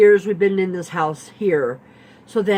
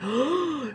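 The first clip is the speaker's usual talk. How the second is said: retold gasp; 8 semitones higher, 5 dB quieter than usual. She remembered my last name.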